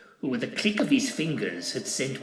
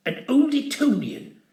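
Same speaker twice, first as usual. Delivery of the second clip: much higher, louder shocked cry; shocked exclamation; 6 dB louder than usual. A narrator's reading. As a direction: gasp it out aghast. Shocked outburst.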